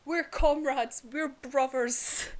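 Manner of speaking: impassioned retold plea, drawn out at the end; passionate. Retelling Inosuke's plea to his friend, passionately. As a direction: imitate a fervent declaration of brotherhood, stretching the words. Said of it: "passionate about it".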